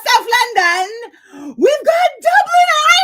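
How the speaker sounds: very high, loud squealing listing of places; delighted high cry breaking into laughter; excited shriek. Real, not acted. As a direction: shriek with glee, laughing at the end. Thrilled by UK viewers.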